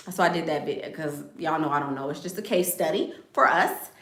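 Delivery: ordinary delivery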